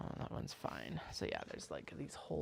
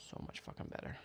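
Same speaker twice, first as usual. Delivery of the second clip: whispered aside; 9 dB quieter than usual. Real, not acted. Muttering to self while trimming.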